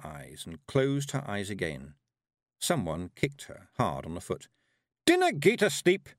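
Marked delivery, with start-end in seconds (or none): 5.1-6.1 loud, high Scottish yell; Scottish shout. A narrator's reading. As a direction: bellow it like a tiny angry Highlander. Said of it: "Rob Anybody shouted".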